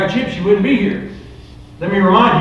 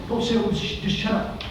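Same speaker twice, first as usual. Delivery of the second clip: hushed; 10 dB quieter than usual. Retold or imitated. Lord's command repeated, hushed.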